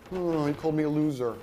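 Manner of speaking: lightly voiced whimper of a hurt follower; mock a sulky crybaby; mock whiny crybaby. Mocking a follower upset at being called loser, whiny. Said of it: "before one of you guys start crying".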